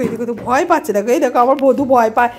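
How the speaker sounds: ordinary talk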